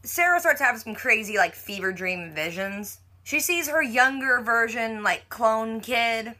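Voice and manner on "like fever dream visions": ordinary delivery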